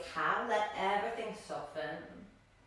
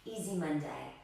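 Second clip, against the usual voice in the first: calm, slightly lowered meditation voice with long pauses; guided meditation; 3 semitones lower than usual. Real, not acted. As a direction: guide them gently into stillness, leaving space between phrases. Guides seated breathing meditation.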